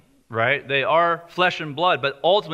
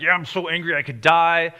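sulky Jonah. Retold or imitated. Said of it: "He's like".

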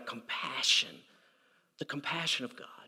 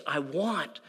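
much softer, tender; God's gentle wish to forgive, softly; 3 semitones lower, 8 dB quieter than usual. Retold or imitated. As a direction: let it fall away quietly. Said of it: "God's inclination is".

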